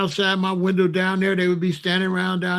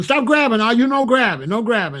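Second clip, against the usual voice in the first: louder, higher referee call; bossy raised-voice warning; calling out orders; 6 semitones higher, 4 dB louder than usual. Retold or imitated. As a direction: break up the fight with a raised voice. Acting out a fight referee.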